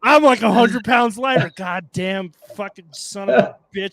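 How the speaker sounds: loud, high, shouting through laughter; laughing outburst. Real, not acted. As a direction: blurt it out in disbelief. Shocked at his old photo.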